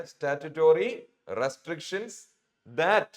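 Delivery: very slow, deliberate reading of an English phrase; slow read-aloud. Shown as a demonstration. Teacher reads the passage aloud to the class, very slowly. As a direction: spell it out word by word so students follow.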